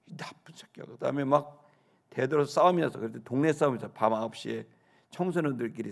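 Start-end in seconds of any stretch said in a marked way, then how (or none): none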